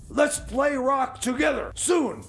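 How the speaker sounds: normal talking voice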